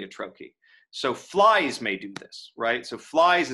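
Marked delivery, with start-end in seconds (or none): 1.1-2.5 "flies" hit hard, then slow "may do this"; trochaic first word. Shown as a demonstration. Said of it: "is gonna take the accents". 3.0-3.5 "flies" punched higher and louder; accented word. Shown as a demonstration. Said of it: "is gonna take the accents".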